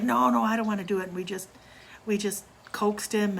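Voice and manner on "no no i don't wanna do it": higher, fast, louder retold no; imitating a scared boy refusing; scared refusal. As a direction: blurt out a frightened refusal. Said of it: "He's like".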